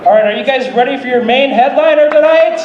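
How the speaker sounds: loud, high announcer voice introducing the headliner; announcer hype. Acted. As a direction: pump up the crowd like an MC.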